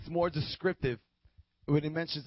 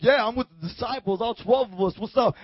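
loud, higher, cocky group boast; bold swagger; 3 semitones higher, 5 dB louder than usual. Acted. Peter among his twelve boys, bold swagger. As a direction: puff it up like one of the boys. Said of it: "They were bold".

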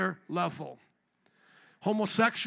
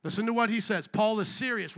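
ordinary delivery

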